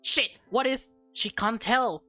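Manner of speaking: usual speaking voice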